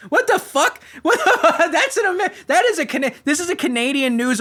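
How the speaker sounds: high, loud laughing outburst; laughing disbelief. Real, not acted. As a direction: laugh out the words in real disbelief. Laughing disbelief at news article.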